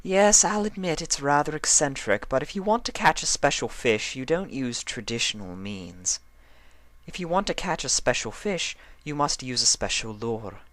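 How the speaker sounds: prim fashionista scolding a fidgety friend; Rarity's voice. A narrator's reading.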